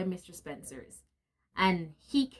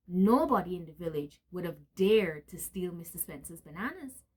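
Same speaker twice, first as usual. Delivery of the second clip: plain reading voice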